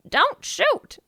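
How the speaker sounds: high, loud parrot squawk; loud mimicking bird voice; parrot impression. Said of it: "That's a good parrot impression".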